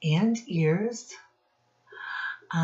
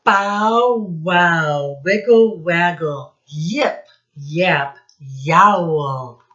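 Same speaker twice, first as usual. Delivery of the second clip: loud, bouncy sound-word chant, slowing at the end; louder sing-song sound effects for children; playful sound words; 6 dB louder, much slower than usual. Shown as a demonstration. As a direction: belt out the sound words for the kids. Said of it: "All sound words".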